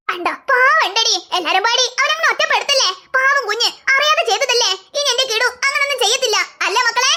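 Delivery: put-on an indulgent grandma taking the boy's side; grandmother defending. Grandmother comforts child.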